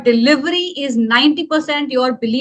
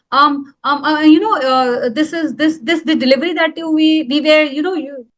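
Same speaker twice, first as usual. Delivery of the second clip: stumbling over ums and repeated words; filler-ridden hesitation; 3 semitones higher than usual. Shown as a demonstration. Coach showing what to do instead of pausing, stumbling with fillers. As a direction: stall and restart, filling every gap. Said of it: "Instead of doing".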